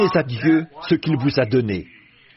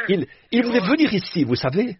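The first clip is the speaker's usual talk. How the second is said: ordinary talk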